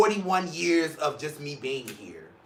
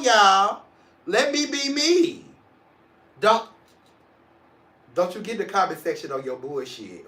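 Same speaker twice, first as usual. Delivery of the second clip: usual speaking voice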